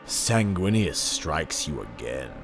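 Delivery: ordinary delivery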